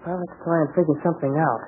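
ordinary delivery

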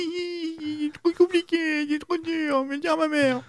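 high wailing fake sob, slow; hammed-up whiny weeping; mock crying. Mocks co-host's whining.

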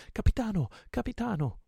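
high, loud call to the captain; joyful cry. A narrator's reading. Pirate hails his captain with a joyful high cry. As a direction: cry out high with joy, voice leaping up. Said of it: "gettò un urlo di gioia".

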